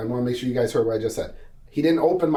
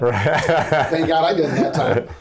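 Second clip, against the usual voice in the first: slow, louder, laughing through it; 2 semitones higher, 5 dB louder, slower than usual. Real, not acted. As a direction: laugh out the relief. Laughing at the traffic stop story.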